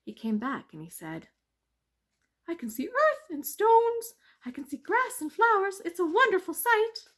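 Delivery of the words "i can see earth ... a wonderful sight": high, loud tricky mouse. Acted. Mouse tricking the bees, high and sly.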